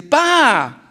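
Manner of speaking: put-on high, loud, drawn-out child call; slow, high, loud excited child; child's excited shout. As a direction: shout it like an eager little boy with an idea. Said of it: "ese muchachito pegó un grito".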